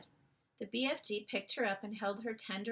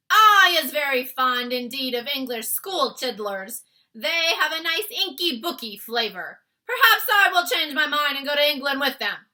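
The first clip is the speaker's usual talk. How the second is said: loud, high bullying giant voice; taunting giant; 6 semitones higher, 15 dB louder than usual. Acted. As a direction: gloat like a brute. Giant gloating over children.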